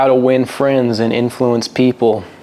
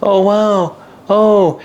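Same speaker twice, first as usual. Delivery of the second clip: high, airy mock sympathy; hollow gushing "oh wow"; fake caring; 7 semitones higher, much slower than usual. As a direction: pretend you care but don't. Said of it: "like, pretends to care".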